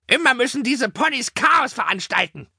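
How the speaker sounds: very high, scolding crow voice; screeching crow. A narrator's reading. Crow scolds the ponies below, screeching. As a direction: screech it down like an irritated bird. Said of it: "kreischte eine Krähe".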